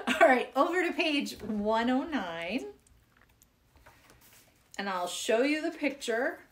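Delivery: ordinary talk, nothing marked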